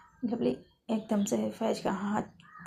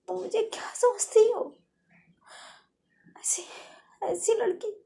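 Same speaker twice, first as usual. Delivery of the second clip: hurt angry outburst; 5 semitones higher than usual. Acted. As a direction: snap back, stung and outraged. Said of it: "ऐसा कह के फैज काफ़ी रोने लगती है".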